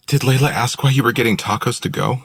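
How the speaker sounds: put-on curious question as a young woman; Willow. A narrator's reading. Willow asks.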